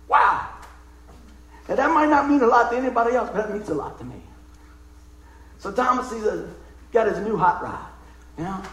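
ordinary talk

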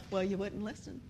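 very soft, low reply; quiet, low-pitched mock retort; retold muted comeback. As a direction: answer back under your breath. Acted reply to the complaint.

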